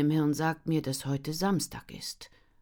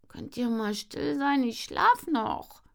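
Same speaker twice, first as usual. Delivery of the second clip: a hint of a grumbling sister, higher, slow; grumbling sleepy sister; 9 semitones higher, slower than usual. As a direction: grumble it, half asleep. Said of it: "murrt Lena".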